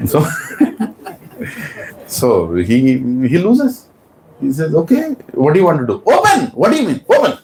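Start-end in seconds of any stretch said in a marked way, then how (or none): 4.7-7.4 king finally giving in to his wife; exasperated king. Acted. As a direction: throw up his hands and shout open. King gives in, orders open.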